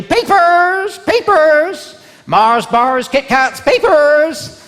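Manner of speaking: high, loud, sing-out vendor call; trolley seller's cry. Acted. Woman with papers trolley hawks her goods, high ringing shout. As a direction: hawk the wares down a hospital corridor. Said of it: "she'd push around her trolley, she'd go".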